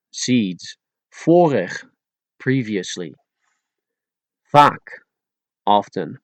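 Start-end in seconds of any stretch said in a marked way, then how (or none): 1.1-1.7 Vorig starting with an F sound. Shown as a demonstration. Teacher making it more Dutch, Vorig with F for V. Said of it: "turning the V into an F sound". 4.5-4.8 Vaak starting with an F sound. Shown as a demonstration. Teacher making it more Dutch, Vaak with F for V. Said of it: "turning the V into an F sound".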